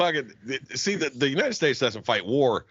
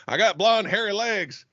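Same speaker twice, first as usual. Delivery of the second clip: high mock politician impression; Joe Biden; 4 semitones higher, slower than usual. Teasing the co-host who banned it, mock presidential impression. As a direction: imitate a rambling old president. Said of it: "Do not reference back to Biden".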